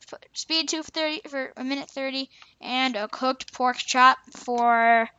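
usual speaking voice

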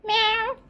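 high, loud mock meow; cat meow. Owner meows like a cat to tease her dog. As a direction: squeak out a cat's meow.

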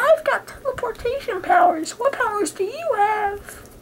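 high squeaky toy voice; toy character voice. Pretend. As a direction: voice the action figure up high. Voicing Jean Grey figure.

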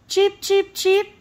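very high cheeping chicks; baby chicks cheeping. A narrator's reading. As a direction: squeak it like tiny fluffy chicks. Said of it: "they cried".

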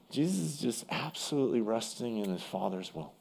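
ordinary talk, nothing marked

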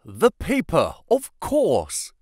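high-pitched cry of sudden realization; excited yell. A narrator's reading. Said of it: "yelled Holmes, in a paroxysm of excitement".

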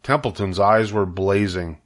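usual speaking voice

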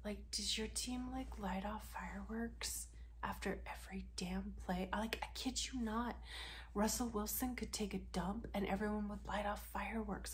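whispered gossip; mock secret whisper. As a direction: keep it a hushed secret. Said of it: "come in close".

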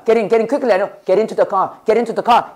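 ordinary delivery

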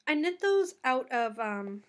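ordinary delivery

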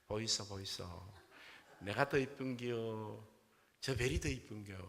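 drawn-out Gyeongsang dialect wheedling; Gyeongsang accent bride. Acted. Gyeongsang bride imitates Seoul bride to her husband, thick Gyeongsang accent. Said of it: "경상도 신부가 보면서 자기도 한번 흉내 내본 거야".

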